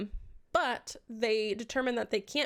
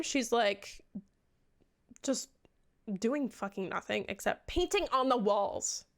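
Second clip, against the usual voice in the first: usual speaking voice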